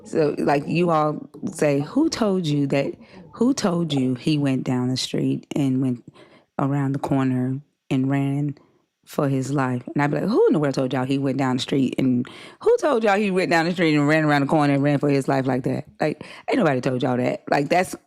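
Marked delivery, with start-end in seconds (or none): 1.8-9.8 careful standard American accent, slowed and fully pronounced; measured code-switched standard speech; standard, non-Southern accent. Shown as a demonstration. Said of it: "that's how we talk here". 10.3-17.4 casual Southern accent, words run together; relaxed Southern speech with slurred-together phrases; Southern country talk. Shown as a demonstration. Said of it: "that's how we talk here".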